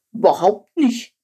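mildly triumphant retort. A narrator's reading. Quark corrects Chilp about the plagues, mildly triumphant. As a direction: answer with a touch of triumph. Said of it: "rief Quark triumphierend".

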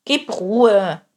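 annoyed lion wanting to be left alone; grumbling. A narrator's reading. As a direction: grumble it firmly, with force. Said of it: "murrte er".

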